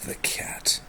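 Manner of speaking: soft voiceless whisper; just breathe the words. Real, not acted. Whispered echo of cat.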